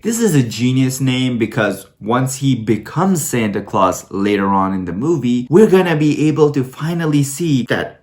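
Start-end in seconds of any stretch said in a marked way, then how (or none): none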